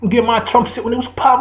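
ordinary delivery

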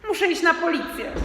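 put-on high, loud boyish cry; boy bursting in to his parents, shouting; panicked shout. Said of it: "krzyknąłem".

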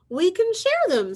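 high, loud sing-song offer; friendly ladybug. Acted. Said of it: "said the friendly ladybug".

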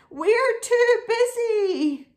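high, slow, loud plea; drawn-out pleading protest; begging. Acted. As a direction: beg off in a high sheep voice. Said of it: "begged the sheep".

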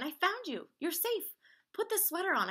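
high, loud, thrilled storybook voice; excited relief. Acted. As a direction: burst out with relief at finding a friend. Porcupine finds lost friend.

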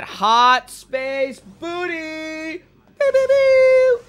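very high, loud "pew, pew"; playful pew pew. Pretend. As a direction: squeak out laser noises. Playful space shooting noises.

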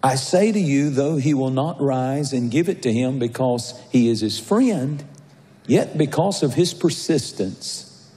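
fervent pulpit preaching. Acted. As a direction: preach it out to the congregation. Preaching to congregation.

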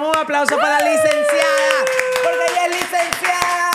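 cheering announcement. Real, not acted. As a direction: whoop it up like calling for applause. Pide aplausos para la recién licenciada. (ovación eufórica, voz muy aguda)

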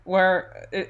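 normal talking voice

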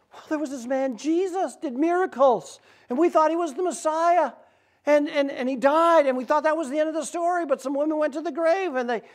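high-pitched, incredulous, urgent recounting; agitated explaining. Retold or imitated. Traveler telling a stranger about Jesus, agitated. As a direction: pour out the news, voice pitched high.

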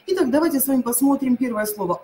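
ordinary talk, nothing marked

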